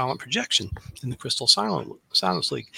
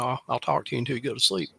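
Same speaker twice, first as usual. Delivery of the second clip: usual speaking voice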